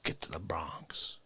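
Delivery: slow, very soft whisper. Acted. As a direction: breathe the punchline slowly. Whispered punchline.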